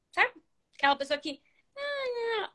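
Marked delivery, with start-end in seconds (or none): 1.7-2.5 high, soft, drawn-out feeble whine; voice won't come out. Shown as a demonstration. Coach mimics a person whose voice won't come out, feeble whine. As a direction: squeeze out a tiny wordless sound, mock-timid. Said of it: "e não, não sai".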